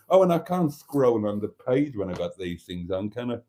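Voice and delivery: normal talking voice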